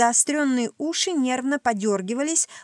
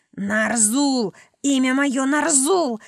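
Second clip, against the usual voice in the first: strained creature rasp, rising louder; hoarse, choked cry; 3 semitones higher, 4 dB louder, slower than usual. A narrator's reading. Throttled creature gives up its name, hoarse cry. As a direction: croak it out under duress. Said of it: "прохрипело существо".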